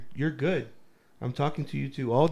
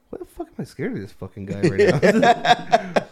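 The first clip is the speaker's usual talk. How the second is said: inner thought; 5 semitones higher, 6 dB louder than usual. Retold or imitated. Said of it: "in my head, I was just like".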